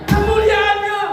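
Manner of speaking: very high, loud climax of the phrase; soaring climax. Real, not acted. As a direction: lift the word to a peak. Climax of sermon point.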